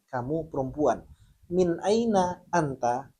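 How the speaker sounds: normal talking voice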